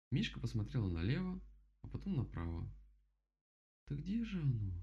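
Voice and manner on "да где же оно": ordinary delivery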